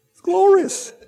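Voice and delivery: high breezy glorious; light gush. Shown as a demonstration. Mocking a casual crowd, a breezy glorious. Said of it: "No, glorious".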